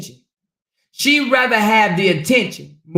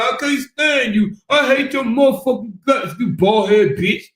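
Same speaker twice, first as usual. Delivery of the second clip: put-on slurring drunk; 3 semitones higher than usual. Mocking a drunk woman cursing her rival, slurred drunken rant. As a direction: imitate a sloppy drunk, higher and dragged out. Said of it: "She starts slurring and shit".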